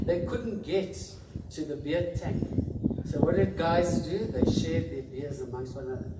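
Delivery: loud, proud and swelling with emotion; passionate. Real, not acted. Passionate Paris memory.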